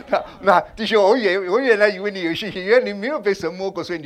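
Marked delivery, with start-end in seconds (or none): none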